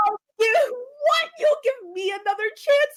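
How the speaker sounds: high, loud, cracking disbelief; incredulous shriek. Retold or imitated. Woman repeats the guy's offer of another chance, shrieking in disbelief. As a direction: shriek it back like you can't believe him.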